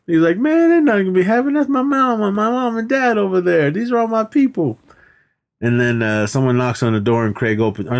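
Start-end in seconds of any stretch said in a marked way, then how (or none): 0.4-4.8 high, quick mock movie character; hamming up a nervous guy's line. Retelling a movie scene, quick high character voice.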